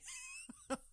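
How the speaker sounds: high squeaky burst of laughter; high giggle. Real, not acted. Laugh between the two apologies.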